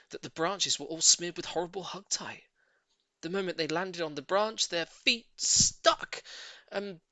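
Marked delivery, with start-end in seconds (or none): 4.7-6.2 very slow, high, stretched words; dramatic slowdown. A narrator's reading. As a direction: draw it out for the doom. Dramatic moment of the trap.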